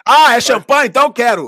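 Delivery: loud, high-pitched playful exclamation; mock eager acceptance. Listener playing the man who suddenly wants champagne, loud mock eagerness. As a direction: burst out in hammed-up eagerness.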